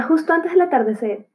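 usual speaking voice

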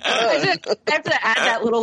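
normal talking voice

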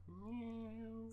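high, soft mock meow; a small distant meow; cat meow. The stray cat back again, high soft meow. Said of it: "And I hear".

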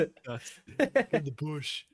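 crackhead voice. Retold or imitated. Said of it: "crackhead impression".

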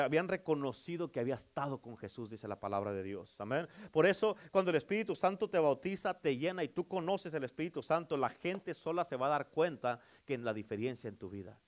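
usual speaking voice

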